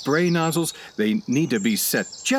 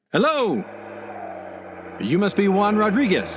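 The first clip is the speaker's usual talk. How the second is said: hailing from a distance; calling out; 7 semitones higher than usual. Acted. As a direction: call out cheerfully across the yard. Calling out to man on tractor.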